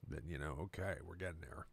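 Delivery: ordinary talk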